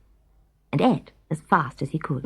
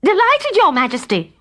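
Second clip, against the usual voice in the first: much higher, louder cheerful call; cook calling back; 10 semitones higher, 4 dB louder than usual. Acted. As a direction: shout down happily to the king. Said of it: "called the cook".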